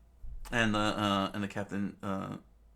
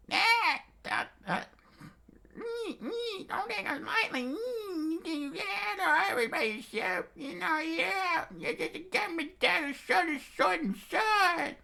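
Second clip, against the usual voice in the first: very high, squealing pig falsetto; strained high pig voice; pig pirate. Acted. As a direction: squeal defiance like a cartoon pig. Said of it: "pig pirate".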